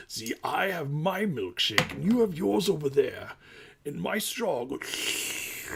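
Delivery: slow, put-on movie villain voice; a drawn-out villain taunt to the shorts; put-on deep voice. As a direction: gloat slowly like a movie villain. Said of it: "That's a deep voice".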